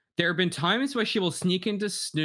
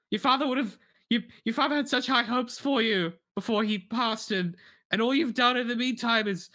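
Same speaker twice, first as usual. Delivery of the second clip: raised, cutting voice of a cold mum; cruel mum character; 6 semitones higher than usual. Acted. Mother sneering at her son Craig, cold and raised. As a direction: belittle your son as his mother. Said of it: "That character came a little too easy".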